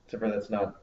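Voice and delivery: ordinary talk, nothing marked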